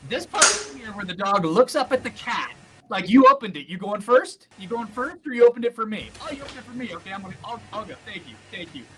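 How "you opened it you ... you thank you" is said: grateful dog. Acted. Dog to cat who opened the door, grateful surprise. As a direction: play a dog marvelling at the cat. Acts out the dog's reaction.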